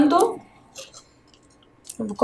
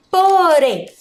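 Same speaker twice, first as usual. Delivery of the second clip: "Porém" drawn out, higher and falling; sounded-out syllables; 5 semitones higher than usual. Shown as a demonstration. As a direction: stretch "Porém" so pupils hear its strong syllable. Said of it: "Qual que é a sílaba mais forte?". ("Porém" alongado para achar a sílaba tônica)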